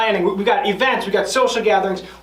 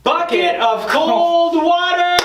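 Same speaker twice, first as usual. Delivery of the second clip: high, slow announcement of the dare; dramatic reveal; 7 semitones higher, slower than usual. Real, not acted. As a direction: drag out the reveal like a game host. Reading the dare off the wheel.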